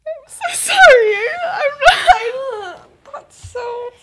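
high, loud tearful cry of apology; really crying, loud and high. Real, not acted. Apologising to her co-host for the sad ending, crying loudly. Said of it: "I'm just so upset".

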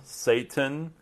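usual speaking voice

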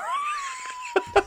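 high squealing laugh burst. Real, not acted. Laughing at himself.

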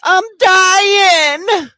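high, loud drawn-out wail; hammed-up stoned panic; mock panic. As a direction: wail it like you think you're dying. Acting out panic while high.

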